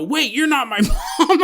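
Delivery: [name] realising, giggling; laughing realisation. Acted. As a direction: crack up mid-realisation.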